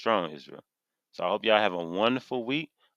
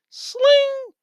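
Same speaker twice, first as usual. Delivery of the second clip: high sharp swish of a blade, much higher and louder; sword swish; 10 dB louder than usual. Pretend. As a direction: slice the air with the word. Imitates a sword sound.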